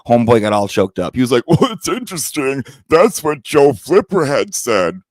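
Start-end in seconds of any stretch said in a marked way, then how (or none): 1.5-5.0 smug host imitation. Imitating Larry's smug reply.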